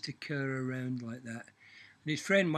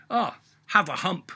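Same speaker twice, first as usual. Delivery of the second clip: loud, higher devil handing over hump; devil's punchline; 5 semitones higher, 9 dB louder than usual. Acted.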